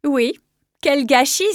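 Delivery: lightly mocking, drawn-out lament. A narrator's reading. Healer answering the mentor about his precious training, mocking. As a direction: feign regret with a teasing lilt. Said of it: "une nuance moqueuse dans la voix".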